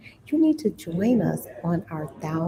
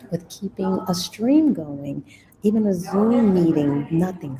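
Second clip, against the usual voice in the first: ordinary talk, nothing marked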